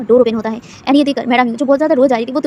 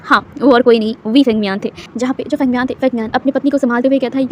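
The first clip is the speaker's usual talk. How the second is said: usual speaking voice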